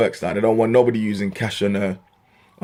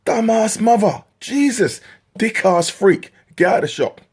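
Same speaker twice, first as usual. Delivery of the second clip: higher, exasperated insults fired off; exasperated outburst; 12 semitones higher, slower than usual. Real, not acted. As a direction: vent at the clueless customer. Ranting at dumb customer.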